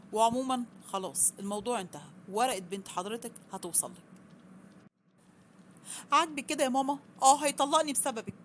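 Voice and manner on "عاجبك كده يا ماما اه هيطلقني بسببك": higher, louder furious cry at her mother; angry outburst. Acted. Daughter screaming at her mother over the divorce, furious shout. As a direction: blame her mother at full volume.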